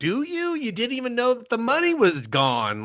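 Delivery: high incredulous sneer; sarcastic high voice. Retold or imitated. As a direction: scoff at them up high. Sarcastic retort to villains.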